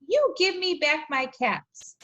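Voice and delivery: a peddler getting cross; mild scolding demand. Acted. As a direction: peddler demands his caps back from the monkeys. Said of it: "he said, shaking a finger at them".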